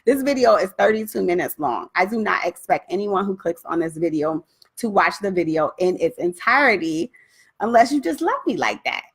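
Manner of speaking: ordinary talk, nothing marked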